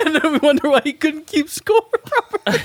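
high-pitched, talking through laughter; laughing tease. Real, not acted. Cohost ribs the scorekeeper over his doodles, laughing hard. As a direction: crack up while getting the jab out. Teasing amid the group's laughter.

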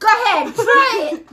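high, loud playful dare. Real, not acted. Daring her to bite.

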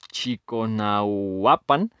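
slow, syllable-by-syllable reading; careful sounding-out. Real, not acted. Host retrying the nine waters' name, careful sounding-out. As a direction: restart the name and stretch it out. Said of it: "no sé cómo se pronuncia".

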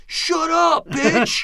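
high, loud heckler's shout; imitated yelling. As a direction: yell it like a fed-up man from the back row. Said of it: "started yelling at her".

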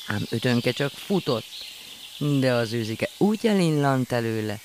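plain reading voice

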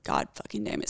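breathless muttered swear under her breath; whispered curse. Retold or imitated. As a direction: whisper it in dread. Seeing police lights arrive.

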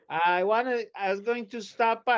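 light mock mother; mimicking the mother. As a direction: casually claim a visit. Mother voiced.

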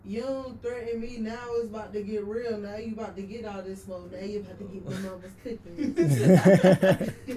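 tough guy voice. Retold or imitated. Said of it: "and he was like".

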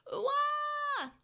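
high startled wah; pained yelp. Acted. Pico Devimon struck in the face, high yelp. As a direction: cry out as the blast hits.

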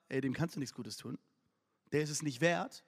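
low, soft dismissive objection; dismissive aside. Retold or imitated. Onlookers talking the king out of kindness, quietly dismissive. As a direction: write him off under your breath.

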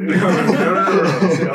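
loud laughing denial. Real, not acted. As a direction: laugh and wave it off. Laughing off the tissues teasing.

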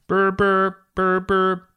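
high trilled telephone burr; loud double ring done with the voice; imitated phone ring. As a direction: mimic an old phone ringing.

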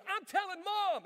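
high, loud little-brother whine; angry kid brother threatening; tattling kid. Retold or imitated. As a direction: threaten to tell, then bolt.

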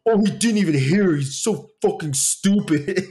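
imitating commenters with exaggerated scorn; mocking critics. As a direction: ham up the smug outrage. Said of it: "you guys would be like".

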